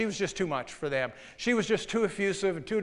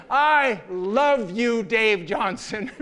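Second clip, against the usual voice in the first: slow, louder, laughing I love you; effusive declaration; 5 dB louder, much slower than usual. Retold or imitated. Woman declares love to her boss in public, slow and effusive. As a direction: stretch it out, over the top and laughing. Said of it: "kept on repeating".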